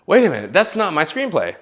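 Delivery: hammed-up double-take; delayed realization. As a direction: catch yourself a beat late. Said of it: "be like".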